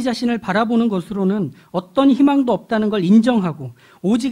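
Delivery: high, loud pulpit voice; impassioned preaching. Real, not acted. Preacher explaining God's purpose to the congregation, impassioned preaching. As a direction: press the point home with rising fervour.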